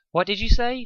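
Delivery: can't believe what she heard; disbelief. A narrator's reading. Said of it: "she asked with disbelief".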